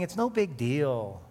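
slow, breezy shrug of a line; mock the world waving it off; lightly voiced casual dismissal; dismissive world voice. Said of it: "the world saying".

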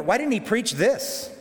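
usual speaking voice

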